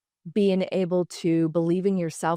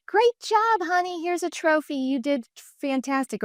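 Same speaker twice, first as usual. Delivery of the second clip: much higher, gushing sing-song praise that trails down; mock gushing praise; 9 semitones higher than usual. Mocking parents who gush over a trophy, sing-song. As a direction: parody empty cheerleading of a child.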